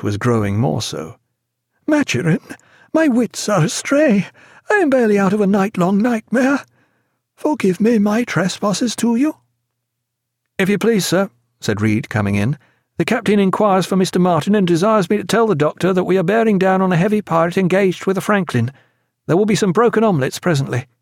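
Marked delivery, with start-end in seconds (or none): 1.9-9.3 high, shaky confession; sick man apologising to the doctor; feverish pleading. A narrator's reading. Feverish patient begging forgiveness. 10.6-20.9 higher, quick dry report; officer reporting to the doctor; lightly voiced young officer. A narrator's reading. Officer brings captain's message.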